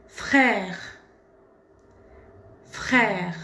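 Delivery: frère with an open è, falling pitch. Shown as a demonstration. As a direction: let frère drop at the end. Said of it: "ça tombe un peu comme ça".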